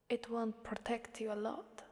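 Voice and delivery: normal talking voice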